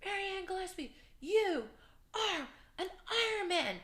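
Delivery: big finish-line announcer call; high, drawn-out race announcer proclamation; Mike Riley. Retold or imitated. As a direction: call it out slowly to the whole crowd. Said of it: "he's got this voice".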